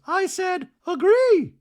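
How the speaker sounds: very high, loud squeaky voice; hammed-up high Dorit voice; mock Dorit. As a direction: insist like a prim diva. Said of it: "Dorit goes".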